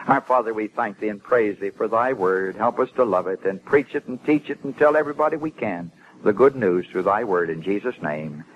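closing prayer. Real, not acted. Preacher thanking God for the Word, reverent closing prayer. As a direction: pray evenly, slowing and sinking at the close.

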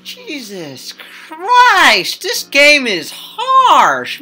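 loud, high, drawn-out exclamation; big, slow, appalled reaction; shocked outcry. Real, not acted. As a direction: stretch the words out in loud dismay. Shocked reaction to grim game text.

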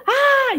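high mock scream of a child; imitating the daughter's high scream. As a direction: let out a high pretend yell. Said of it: "Otra vez".

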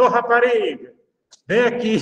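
high, loud, brusque call; imitating a crude summons to a waitress; mock rude call. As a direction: bark it across the restaurant.

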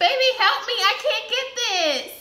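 urgent half-laughing call; frantic asking; panicked plea. Real, not acted. Struggling to pull wax.

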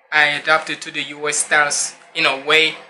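ordinary talk

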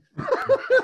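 a sudden, loud, high cackle; loud high laugh. Real, not acted. Laughing about going back to the woods, loud high laugh. Laughs at his own story.

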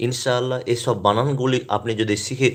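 ordinary delivery

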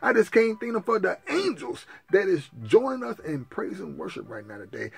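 a grateful rolling prayer; prayer of thanksgiving. Acted. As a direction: give thanks to the Heavenly Father.

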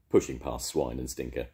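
plain reading voice

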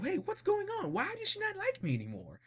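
high, bewildered squeaky voice; confused Jonathan. Retold or imitated. Jonathan baffled that his girl avoids him, high confused whine. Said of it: "confused, like".

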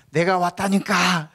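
slow, high, loud insistent call. Retold or imitated. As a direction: holler it, dragging the words out. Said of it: "더 큰 소리로".

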